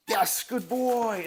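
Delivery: high, slow, drawn-out praise; sing-song praise for a puppy; praise to dog. Shown as a demonstration. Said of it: "When your teeth come off".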